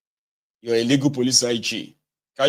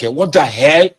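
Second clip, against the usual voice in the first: rising higher and louder to a shrill outburst; outraged disbelief; 7 semitones higher, 9 dB louder than usual. Acted. Someone hearing Nigeria explained, exploding in loud disbelief. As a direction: let the outrage climb with each "what". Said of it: "You know, like that".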